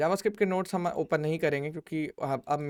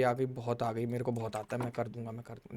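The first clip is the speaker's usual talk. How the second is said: lower, softer imitation, fading away; mock student bragging; 4 semitones lower, 8 dB quieter than usual. Teacher mimics students falsely claiming they understand, lower and fading. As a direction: toss off the empty claims, trailing quieter.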